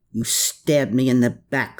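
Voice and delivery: usual speaking voice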